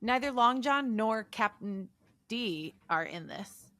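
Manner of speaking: ordinary talk, nothing marked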